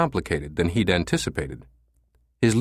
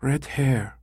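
higher woman's voice, puzzled echo; receptionist repeating; 5 semitones higher, 3 dB quieter than usual. A narrator's reading. Receptionist repeating caller's words, pleasant female voice. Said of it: "she repeated".

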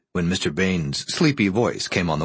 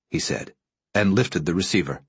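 usual speaking voice

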